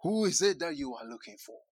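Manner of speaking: ordinary delivery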